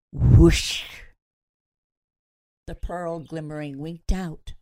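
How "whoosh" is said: a loud rushing whoosh, far-off timbre. A narrator's reading. Narrator sounding the box swallowing Henry, loud whoosh. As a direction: blow it out like air sucked away.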